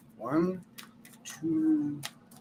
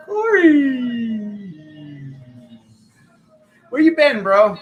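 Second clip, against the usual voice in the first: loud, high call to a friend; welcoming Corey back with a loud, high call; excited greeting; 8 semitones higher, 9 dB louder, slower than usual. Real, not acted. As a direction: shout hello across the room. Greeting a returning player loudly.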